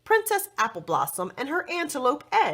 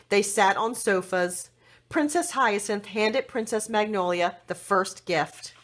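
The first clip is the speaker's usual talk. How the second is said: ordinary delivery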